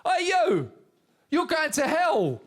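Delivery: high, loud preacher's yell; loud fire-and-brimstone yell, slow on the threat; street preacher shouting. Retold or imitated. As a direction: bellow it like a street preacher condemning a passer-by. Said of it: "this street preacher shouted at me".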